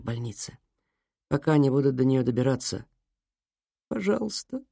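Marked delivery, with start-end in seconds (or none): 3.9-4.6 sobbing. Acted. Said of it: "всхлипнула Лу".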